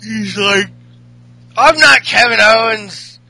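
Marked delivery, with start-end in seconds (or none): 1.6-3.2 loud, raised mock-Irish wrestler retort; Sheamus. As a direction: bellow it like a caricatured Irish brawler. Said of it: "what was it Sheamus had to say?".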